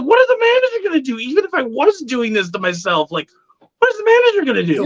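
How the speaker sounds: fast, high-pitched retold panic; incredulous squeal, racing through the question; flustered protest, voice leaping up; freaking out. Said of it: "I'm freaking out".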